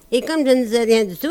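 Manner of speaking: quick patter, tossed away; rattled off fast. Acted. Comic singer rattling off the refrain, fast patter. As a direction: rush through the refrain line.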